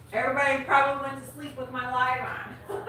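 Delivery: giggling at his own joke; laughing. Real, not acted.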